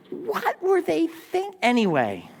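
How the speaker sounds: high, slow incredulous question; playful shock at old fashions; hammed-up disbelief; mock disbelief. Mocking old shorts.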